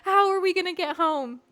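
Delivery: high, laughing incredulous questions; laughing disbelief. Retold or imitated. Quoting herself confronting driver.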